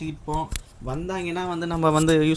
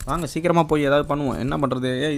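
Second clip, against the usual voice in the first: usual speaking voice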